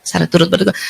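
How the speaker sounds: quiet sympathetic murmur; soft condolence. Shown as a demonstration. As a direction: say it gently to a grieving friend. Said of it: "paling cocok ngomongnya apa".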